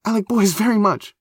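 high, loud, over-earnest declaration; high-pitched, too-loud clarification; flustered blurt. Acted. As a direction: blurt it out in embarrassment.

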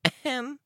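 very high, pointed ahem; Looksee. A narrator's reading. Looksee objecting to talk about preteens, high ahem. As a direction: clear the throat up high for attention. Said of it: "Looksee said".

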